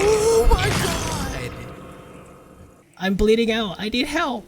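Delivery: high panicked shriek; panicked scream. Real, not acted. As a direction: scream in sudden terror. Jump scare, attacked in game.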